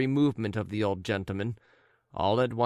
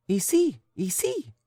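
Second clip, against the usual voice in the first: high French call to the dog; 10 semitones higher, 4 dB louder than usual. Acted. Lady calling her dog in French, high and urgent. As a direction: coax the dog over, high.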